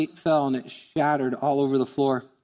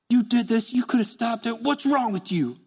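mad at God; 6 semitones higher than usual. Retold or imitated. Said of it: "Mad at God".

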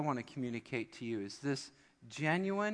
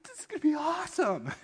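put-on high squeaky child voice full of glee; high thrilled kid boast; excited kid. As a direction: cheer like an eager little boy.

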